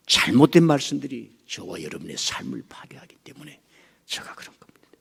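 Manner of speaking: ordinary talk, nothing marked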